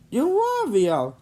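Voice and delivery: high, slow wonder; amazed. Acted. Jimmy meeting Santa at last, amazed slow wonder. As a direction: marvel at finally seeing him. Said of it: "Jimmy said amazed".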